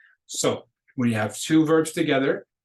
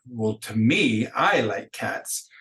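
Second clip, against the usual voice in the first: "me" stressed, slow and deliberate; emphasis on me; slower than usual. Shown as a demonstration. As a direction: lean on "me" then slow "I like cats". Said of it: "if you wanna emphasize that".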